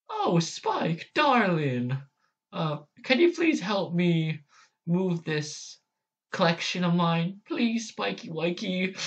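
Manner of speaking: high posh lady voice; Rarity impression. Vlogger trying Rarity asking Spike for help, high and prim. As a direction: swoon out darling like a fancy diva. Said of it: "I don't like that voice very much".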